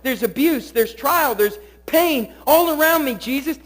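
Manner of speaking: building from pleading to a high, loud cry at Jesus; anguished plea. Acted. Voicing a sufferer crying to Jesus.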